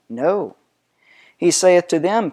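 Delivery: usual speaking voice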